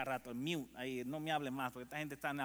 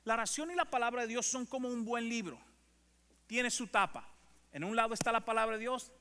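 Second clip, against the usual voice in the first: louder, higher declaration; emphatic preaching; 6 semitones higher, 6 dB louder than usual. Acted. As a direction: proclaim it to the room.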